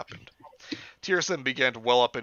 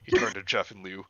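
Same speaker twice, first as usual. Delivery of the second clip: ordinary delivery, nothing marked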